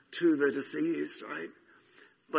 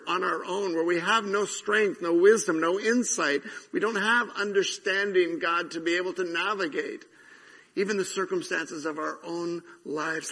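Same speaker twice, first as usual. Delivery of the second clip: prayer spoken with rising force; fervent prayer; 4 semitones higher, 5 dB louder than usual. Acted. Pastor praying over the church, fervently. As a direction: lift the prayer up, pleading.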